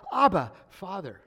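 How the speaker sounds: high, loud cry, falling on Father. Retold or imitated. Preacher quoting the children's cry to God, crying out. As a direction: call out to a father. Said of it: "by which we cry out".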